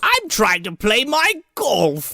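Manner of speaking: high, drawn-out British complaint; hammed-up British golfer, stretching every word; mock British gentleman. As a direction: protest slowly in a put-on British accent. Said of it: "thinks he's from Britain".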